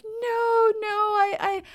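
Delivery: high-pitched mock pleading. Senta protesting her love to the Dutchman, mock pleading. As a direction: plead in a high, hammed-up heroine voice.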